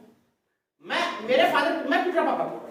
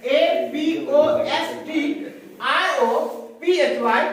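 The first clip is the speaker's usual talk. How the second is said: spelled out letter by letter; much slower than usual. Shown as a demonstration. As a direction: spell it out slowly, one letter at a time. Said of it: "इसका उच्चारण भी हमने लिख दिया है". (अक्षर-अक्षर धीरे और ज़ोर से बोलना)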